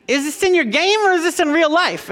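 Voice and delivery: high, squeaky replay of himself; mock himself as a frazzled dad; mock exasperated dad. Dad retelling telling his son, high comic squeak. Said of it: "I was like".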